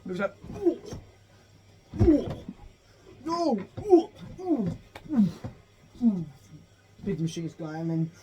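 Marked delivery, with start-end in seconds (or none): 0.6-6.2 short high "ooh" cries; cartoon battle effort noises; imitated fight yelps. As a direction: act out each throw with a yelp. Imitates the mech fight again.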